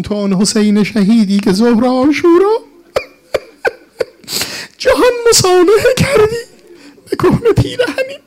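high, wailing lament verse; tearful elegy. Real, not acted. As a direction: voice climbing into weeping. Old elegy recited in tearful lament.

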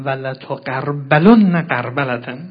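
slow, loud Arabic declamation; sermon recitation. Acted. Preacher quoting the Imam's next phrase, solemn recitation. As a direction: proclaim it with drawn-out syllables.

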